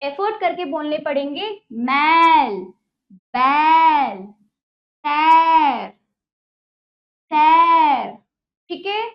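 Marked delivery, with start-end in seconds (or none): 1.7-8.1 loud, forceful drill words; loud teacher drill; said with effort. Shown as a demonstration. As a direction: push each word out with effort. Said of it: "इसमें थोड़ा सा आपको जोर लगाना पड़ेगा".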